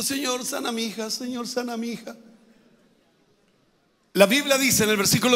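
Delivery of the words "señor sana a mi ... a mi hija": soft pleading mother. Acted. Mother pleading, voiced softly.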